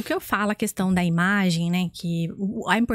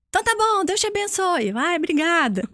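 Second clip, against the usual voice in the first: re-enacted parting exchange, pitched very high; called-out farewell; 8 semitones higher than usual. Stranger and woman part at the corner, called-out farewell. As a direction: call a blessing across a square, high. Calling goodbye across the square.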